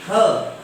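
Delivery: "kha" loud, with a burst of air on the k; aspirated kha. Shown as a demonstration. Teacher showing how ka changes, aspirated kha. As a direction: breathe out hard on the k of "kha". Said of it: "ka becomes".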